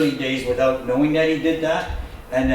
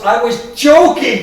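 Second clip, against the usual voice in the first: amused reveal; 8 semitones higher, 10 dB louder, slower than usual. Retold or imitated. Lady reveals joke.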